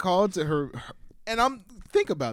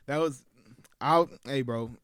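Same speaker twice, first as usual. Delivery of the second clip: ordinary delivery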